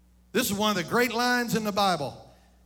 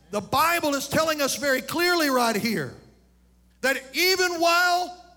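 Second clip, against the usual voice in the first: higher, louder, driving preaching; rising preacher's climax; 7 semitones higher, 4 dB louder than usual. Acted. Preacher urging parents to bless their children, building fervor. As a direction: build to a shout, no letting up.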